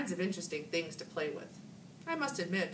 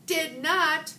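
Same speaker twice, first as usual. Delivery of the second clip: loud sharp denial; angry shout; 11 semitones higher, 9 dB louder than usual. A narrator's reading. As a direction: shout it back at her. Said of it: "Heidi shouted".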